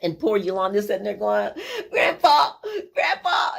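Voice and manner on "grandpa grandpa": high, frightened cry for grandpa; a stuck little girl calling out high and scared; scared child calling. Retold or imitated. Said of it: "she's just so scared".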